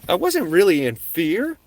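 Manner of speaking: ordinary talk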